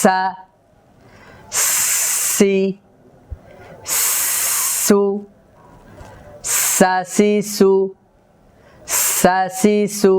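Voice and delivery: long sharp hissed s before the vowel in সা, সি, সু; strong breath sibilant. Shown as a demonstration. Teacher showing the letter seen, strong hiss before সা, সি, সু. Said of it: "শ্বাসের আওয়াজটা খুব তেজ আওয়াজের সাথে দেব".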